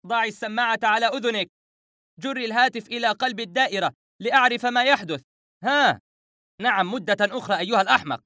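very high, frantic phone shouting. Acted. Narrator yelling to Howaida and the operator, frantic. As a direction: shout orders in a high, impatient pitch. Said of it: "فعدت أصرخ".

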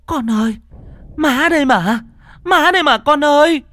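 put-on a mother crying out to her lost child; wailing mother. A narrator's reading. Mother wails over mad daughter. (giọng gào khóc gọi con)

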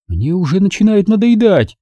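irritated Margo. A narrator's reading. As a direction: let the impatience show.

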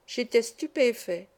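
ordinary delivery

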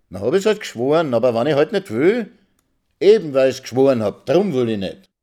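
high, loud, stubborn; wife defying husband; defiant retort. Acted. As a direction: throw it back at him. Wife defies, quarrel.

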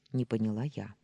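ordinary delivery

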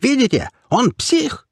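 very high, slow falsetto shriek; falsetto squeal. A narrator's reading. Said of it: "фальцетом взвизгнул хомяк".

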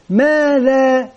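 drawn-out teacher prompt; slow drawn-out question. Shown as a demonstration. Teacher prompting pupils with a slow drawn-out question. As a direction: stretch the word out slowly to prompt pupils.

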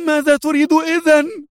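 high furious shout. Acted. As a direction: shout it at him. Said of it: "وهي تصيح".

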